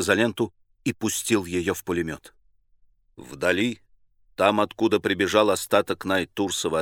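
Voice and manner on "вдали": plain reading voice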